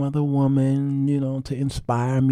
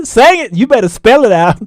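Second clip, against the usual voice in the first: loud, higher encouraging chant; cheering wife; 10 semitones higher, 10 dB louder than usual. Retold or imitated. Wife cheering her husband's song, loud and high. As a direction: cheer him on like a hype woman.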